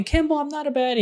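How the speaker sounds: put-on high-pitched indignant child's protest; upset child protest. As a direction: play an upset little girl insisting she's not a baddie. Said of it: "she was huffing and puffing".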